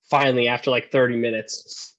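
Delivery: usual speaking voice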